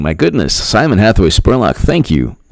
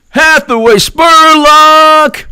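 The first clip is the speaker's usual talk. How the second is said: exaggerated announcer call; much slower than usual. Dramatic name call-out.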